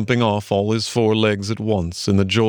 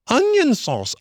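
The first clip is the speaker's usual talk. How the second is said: high, sing-song jeer; mock the rabbits; jeering taunts. A narrator's reading. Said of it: "he remarked jeeringly".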